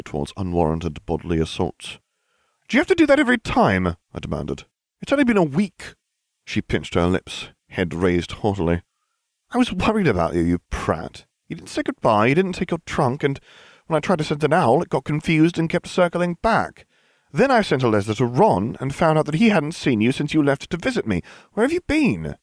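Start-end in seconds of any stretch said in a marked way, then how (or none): 2.7-4.0 put-on high, fast exasperated girl; a young witch snapping at her friend; Hermione's voice. A narrator's reading. Hermione scolding Harry. 5.0-22.4 put-on high worried scolding girl; a bossy schoolgirl's voice; Hermione's voice. A narrator's reading.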